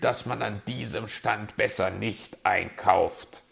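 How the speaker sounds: mildly angry, loud scolding; grim fox. A narrator's reading. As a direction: scold with an I-told-you-so edge. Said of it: "sagte der Fuchs grimmig".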